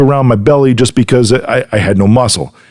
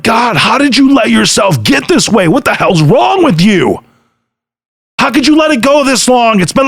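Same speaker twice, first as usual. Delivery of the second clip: telling Andy how he chewed himself out in the mirror, in a raised, scolding voice; retold self-scolding; 8 semitones higher than usual. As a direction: scold yourself in the mirror, disgusted. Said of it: "blasting myself mentally".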